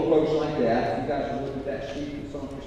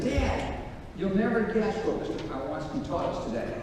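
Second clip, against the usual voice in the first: lightly voiced eager young son, pitched higher; son's excited voice; 6 semitones higher than usual. A son telling his dad about his teacher, lightly voiced and eager. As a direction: play the boy bursting with news from school. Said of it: "He said".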